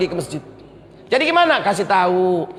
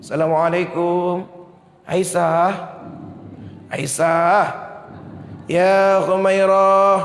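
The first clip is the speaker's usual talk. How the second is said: gentle calling; 4 semitones lower, much slower than usual. Acted. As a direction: wake her as gently as possible. Rasulullah gently wakes Aisyah.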